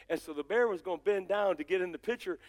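ordinary delivery

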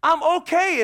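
loud high call to a sister; retold scream. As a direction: holler reassurance to your sister. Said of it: "and I'm screaming".

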